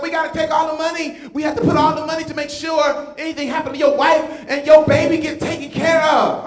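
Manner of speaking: a hint of the fired-up wife; lightly voiced wife. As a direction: lay down the law about the money. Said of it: "She goes".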